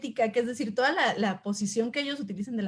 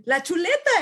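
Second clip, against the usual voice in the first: higher and louder, stubbornly insistent; insisting student; 7 semitones higher, 5 dB louder than usual. Retold or imitated. Student correcting the teacher, insistent and raised. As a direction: hammer home "chuleta" as if it's obvious.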